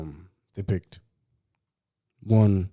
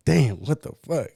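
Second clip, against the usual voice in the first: retold exasperation; 6 semitones higher, 6 dB louder than usual. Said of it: "So I'm like".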